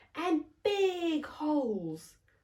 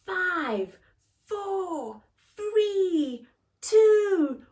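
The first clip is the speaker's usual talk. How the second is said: countdown climbing higher and louder; building countdown; 10 semitones higher, 8 dB louder, much slower than usual. Acted. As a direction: build the excitement to launch. Countdown to rocket launch.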